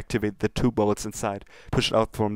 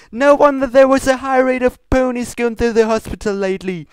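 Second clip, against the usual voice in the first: loud, high, frantic cartoon shouting; over-the-top manic outburst; 12 dB louder than usual. Shouting about the bolt cupcakes, loud and frantic.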